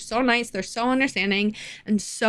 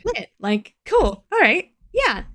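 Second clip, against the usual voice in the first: high, bright fake-cheerful okay; 5 semitones higher, slower than usual. To the cashier, a high fake-cheerful okay. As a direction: chirp it like nothing's wrong. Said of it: "So I'm like".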